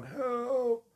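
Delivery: high, thin, dying plea for help; fading dying voice. Retold or imitated. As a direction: beg weakly like a dying man. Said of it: "dying voice going".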